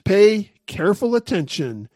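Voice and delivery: usual speaking voice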